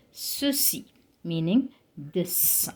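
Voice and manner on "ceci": ceci with two S sounds; French soft C. Shown as a demonstration. Teacher showing both combinations in one word, ceci with S sounds. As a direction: say ceci as "suh-see", slightly set apart. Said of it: "one word with both combinations".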